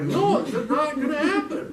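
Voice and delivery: high, drawn-out shouted refusal; hammed-up, slow, loud no; arguing. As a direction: protest loudly at the voice. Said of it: "I'm arguing with this".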